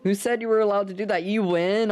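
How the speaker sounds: high, fast outraged squeal; mock outrage. Real, not acted. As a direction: rattle it off in high indignation. Outraged at viewer's trick.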